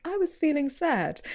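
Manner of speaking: high, unraised confession in imitation; imitated interviewee. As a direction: quote a guest in a lifted, plaintive pitch. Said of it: "Or someone says".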